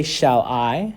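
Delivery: "shall I" with a longer, rising I; stress on I. Shown as a demonstration. Teacher asking students to notice the stress, stress on I. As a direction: keep shall short, draw out I in "shall I". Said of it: "the I is a little bit more drawn out".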